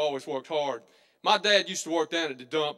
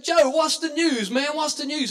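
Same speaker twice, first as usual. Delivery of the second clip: eager calling out; 8 semitones higher than usual. Acted. The loafers calling Joe over for gossip, eager shout. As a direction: holler across the store.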